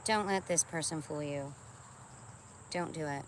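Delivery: ordinary talk, nothing marked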